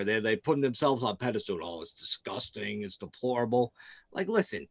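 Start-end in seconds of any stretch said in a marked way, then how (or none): 1.6-3.7 softly imitating prim disgust; mock-proper disapproval. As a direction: mimic sanctimonious radio hosts, hushed and lower. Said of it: "These guys are so, like, proper".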